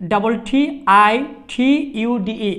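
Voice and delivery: ordinary delivery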